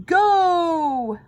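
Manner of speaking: loud high roar of Go. A narrator's reading. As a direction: roar the start. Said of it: "roared the coach".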